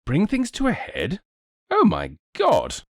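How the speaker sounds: high, incredulous echo; aghast cry. A narrator's reading. Nobleman hears the detective's little idea, crying out aghast. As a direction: cry out in high-pitched disbelief. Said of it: "cried Lord Yardley".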